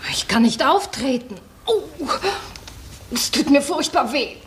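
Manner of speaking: pained whisper; whispered refusal. Acted. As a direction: breathe it out, hurting. Injured actress answers backstage. (leise geflüstert, mit Schmerz)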